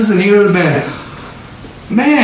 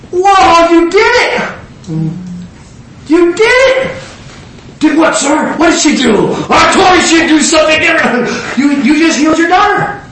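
elated shout; 11 semitones higher than usual. Retold or imitated. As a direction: burst out in delight at her faith. Jesus voiced elated, shouting.

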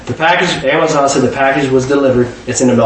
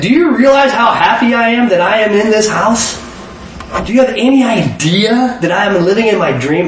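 overjoyed; 7 semitones higher than usual. Retold or imitated. Telling the owner his joy.

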